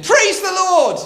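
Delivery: high, loud shout of praise. Acted. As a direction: shout it out from the porch. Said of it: "stood on her porch and shouted".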